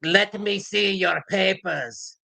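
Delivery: put-on officious demand, drawn out; mock papers inspector. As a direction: draw out the demand like a checkpoint officer. Mocking demand for identity papers.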